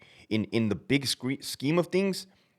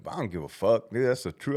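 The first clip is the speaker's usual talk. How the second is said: usual speaking voice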